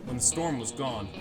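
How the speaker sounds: plain reading voice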